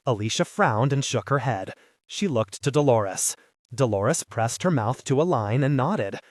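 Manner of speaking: plain reading voice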